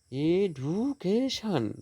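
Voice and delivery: "Education" very slowly, every syllable stretched; high, drawn-out syllables in "education", falling at the end; slow stress demonstration. Shown as a demonstration. Teacher showing word stress, "education" slow and stretched. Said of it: "last syllable stressed".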